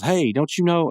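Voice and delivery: mild mock yell at the punks; imitated yell. Mordecai confronting the two punks, imitated yell. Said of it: "he yells".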